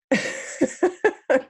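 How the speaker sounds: real giggle at her own joke; laugh. Real, not acted. Coach laughing at her own refusal, amused. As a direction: burst into a light, high laugh.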